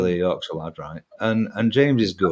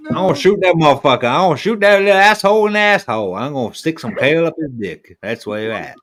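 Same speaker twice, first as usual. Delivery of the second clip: Yosemite Sam; 3 semitones higher, faster than usual. Acted. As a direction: fire off hammed-up gunslinger threats, then settle. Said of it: "uh, Yosemite Sam".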